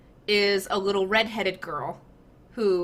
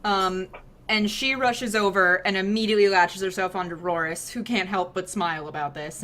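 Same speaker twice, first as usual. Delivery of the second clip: ordinary talk, nothing marked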